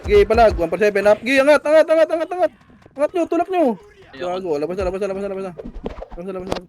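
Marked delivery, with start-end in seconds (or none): 1.2-3.8 urgent rallying call. Real, not acted. Shotcaller urging team forward.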